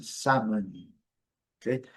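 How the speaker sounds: normal talking voice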